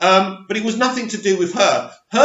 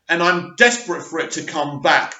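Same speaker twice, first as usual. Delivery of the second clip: usual speaking voice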